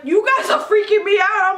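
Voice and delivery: high, loud shout with laughter; freaked out. Retold or imitated. Mother protesting to the nurses, freaked out. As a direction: act out panicked protest, half laughing. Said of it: "I was so freaked out".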